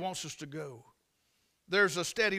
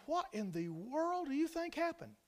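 high, hushed incredulous question; acted amazement; 7 semitones higher, 8 dB quieter than usual. Listener asking guide why the bear left, acted amazement. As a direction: ask it softly, pitched up in wonder.

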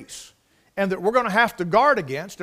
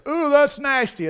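mock disgust; 6 semitones higher, 5 dB louder than usual. Onlookers reacting to pierced person.

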